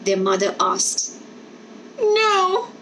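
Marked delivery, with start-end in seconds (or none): 2.0-2.7 put-on high protesting child's no; children refusing to leave; children crying no. A narrator's reading. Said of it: "they cried".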